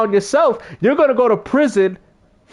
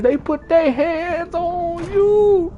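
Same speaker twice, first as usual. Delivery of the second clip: high and very slow, drawn-out quote; Chris Tucker impression; 10 semitones higher, much slower than usual. Streamer quoting the movie line once more, high and slow. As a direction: savour each word like the comic. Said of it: "that was hilarious".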